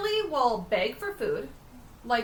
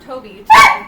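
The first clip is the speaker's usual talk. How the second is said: calling out; 20 dB louder than usual. Real, not acted. Calling out sharply to the dog.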